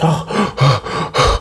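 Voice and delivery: gasped, breath-heavy ohs; mock freaking out. Spencer ambushed by the fridge, mock freak-out. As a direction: act startled with panicky gasps. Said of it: "my boy was freaking out".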